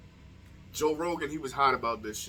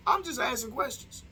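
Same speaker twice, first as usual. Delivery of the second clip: high-pitched put-on innocence; mock innocent; 10 semitones higher than usual. Vlogger fending off backlash from viewers, high mock-innocent voice. Feigned innocence after provocative question.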